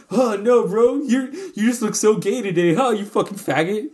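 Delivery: hammed-up joking put-down; mock teasing. Soldier imagining his roommate joking it off, mock teasing.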